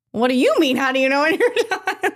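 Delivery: stunned echo of the women's question; incredulous echo. Retold or imitated. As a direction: throw their question back in disbelief. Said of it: "I'm like".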